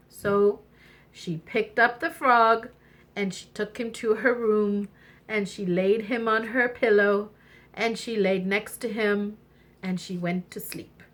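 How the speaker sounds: usual speaking voice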